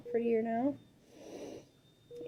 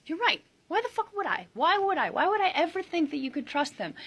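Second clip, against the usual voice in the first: sarcastic; 7 semitones higher than usual. Real, not acted. Sarcastic rant at commenters.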